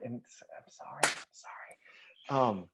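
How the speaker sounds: hushed, barely voiced sorry; whispered apology. Real, not acted. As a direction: whisper an apology under your breath. Whispered apology after being teased for whispering.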